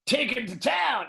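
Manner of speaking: high, slow mock rallying shout; hammed-up American-style shout, pitch way up; mock battle cry. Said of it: "shouting things that Americans would shout".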